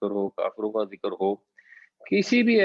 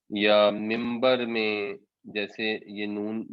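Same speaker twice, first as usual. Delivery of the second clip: ordinary talk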